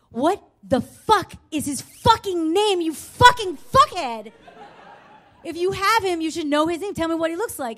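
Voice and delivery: loud, high cursing, word by word at first; acted-out exasperated yell at the caller; furious outburst retold. She curses back at the caller.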